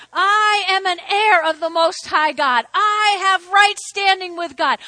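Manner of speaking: loud tearful proclamation of faith; crying declaration. Real, not acted. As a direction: cry it out with conviction, voice breaking. Preacher declaring identity, crying out.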